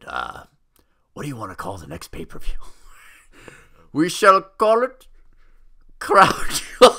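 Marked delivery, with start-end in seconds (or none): 3.9-6.9 pompous mock prince. Voicing the prince naming it.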